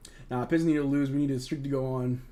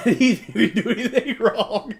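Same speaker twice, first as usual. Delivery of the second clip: laughing, higher and louder, slow; 7 semitones higher, 6 dB louder, slower than usual. Real, not acted. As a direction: defend him through laughter. Laughing defense of player.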